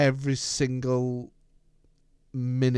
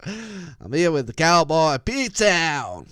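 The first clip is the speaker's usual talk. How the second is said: slow hammed-up American cowboy accent; mock cowboy accent; 3 semitones higher, 4 dB louder, much slower than usual. Introducing his co-host as a cowboy, slow mock American accent. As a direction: drawl it slowly like a cartoon cowboy. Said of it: "I don't know what accent that was".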